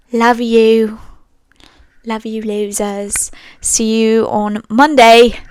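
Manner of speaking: drawn-out, badly faked British sign-off; terrible British accent. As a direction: put on an exaggerated amateur British accent. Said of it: "that is a terrible accent".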